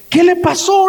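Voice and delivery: startled watchman asking what happened, high and alarmed; shocked question. Retold or imitated. Guard shocked at bloody man.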